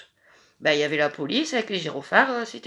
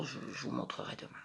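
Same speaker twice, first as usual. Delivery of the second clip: whispering; 7 semitones lower, 16 dB quieter, much faster than usual. Real, not acted. Quick aside to viewers.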